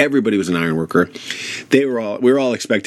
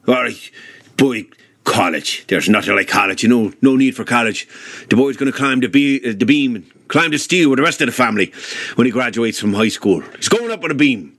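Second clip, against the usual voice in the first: Paddy Flynn impression; 3 semitones higher than usual. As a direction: do grandfather Paddy's Irish brogue. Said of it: "I wanna hear a little of Paddy Flynn".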